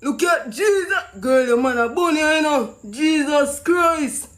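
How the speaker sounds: usual speaking voice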